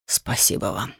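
low, hushed near-whisper; whispered thanks. A narrator's reading. Kneeling father thanking the artist, in a whisper. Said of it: "прошептал он, глядя на художника со слезами".

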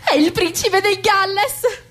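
annuncio acuto e lento, estasiato; rivelazione sconvolta. Acted. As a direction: proclama la scoperta allungando le parole, voce altissima. Revelation of royal guest. (stupore melodrammatico)

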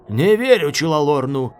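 loud growled refusal; hoarse growl. A narrator's reading. Smoker warning Silk against the king, growling. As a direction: growl out your distrust. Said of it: "прорычал Дымокур столь хрипло".

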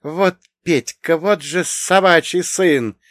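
high, loud, drawn-out exclamation; loud high-voiced outburst; amazed cursing. A narrator's reading. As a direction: curse him in gleeful disbelief, slowly. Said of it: "начала она смеяться".

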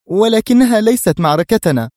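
high, loud, exasperated outburst; angry cry. A narrator's reading. Mona angrily rejecting his fight, exasperated. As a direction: fling it at him in frustration. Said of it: "هتفت في حنق".

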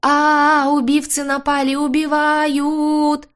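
high, loud wail with a long-drawn ending; shrieked, stretched-out alarm; screaming at full strain. A narrator's reading. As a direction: howl murder for all to hear. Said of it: "надрывалась я".

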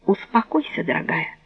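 soft, calming husband; soothing. Acted. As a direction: gently hush her down. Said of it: "старался он утихомирить её".

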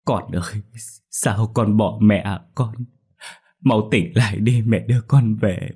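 mild acted wail over the body; a touch of mourning cry. Mother grieving over her daughter's body, lightly wailing. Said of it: "mà gào khóc".

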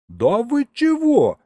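high, loud, drawn-out protest; shocked disbelief. Acted. Narrator learns churches charge for rites, loudly incredulous. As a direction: blurt it out, appalled and high.

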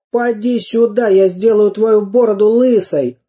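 high-pitched scrappy threat; taunting challenge. A narrator's reading. Самоделкин dares the pirate to come closer, taunting.

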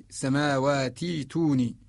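slow السماوات توني, long ee joining the words; Warsh long-vowel reading. Shown as a demonstration. Teacher reading for Warsh, السماوات توني slowly with a long ee. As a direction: hold an ee between السماوات and توني, no hamza. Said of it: "نجعلها حرف مد مجانس للكسرة".

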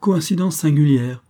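plain reading voice

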